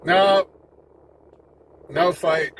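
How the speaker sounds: normal talking voice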